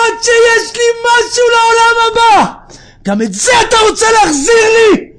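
high, very loud anguished yell; acted shouting protest. Sick man refusing to have his merit repaid, anguished shout. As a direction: protest at the top of your voice. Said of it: "הוא התחיל לצעוק".

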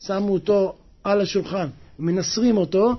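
ordinary talk, nothing marked

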